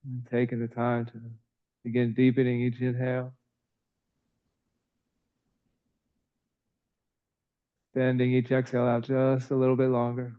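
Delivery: calm, even yoga-teacher voice with long pauses; soothing, evenly paced breath cues; guided breathing meditation. Real, not acted. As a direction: guide the breath gently, in an unhurried monotone.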